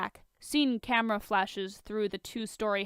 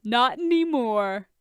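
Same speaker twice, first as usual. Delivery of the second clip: teasing; 3 semitones higher, 5 dB louder than usual. Acted. Tony teasing Bruce.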